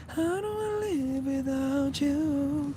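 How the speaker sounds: sung very high and soft, slowly; falsetto. Shown as a demonstration. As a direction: float it up in falsetto. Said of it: "in your falsetto".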